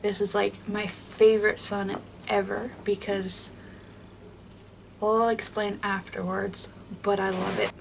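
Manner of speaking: usual speaking voice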